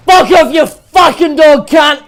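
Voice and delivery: high, full-volume rage shout; furious scream. Real, not acted. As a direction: scream it out in pure fury. Killed, rages at enemy.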